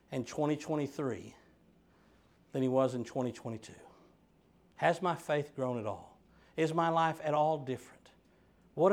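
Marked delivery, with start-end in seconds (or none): none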